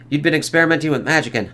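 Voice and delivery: plain reading voice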